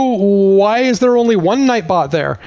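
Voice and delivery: ordinary delivery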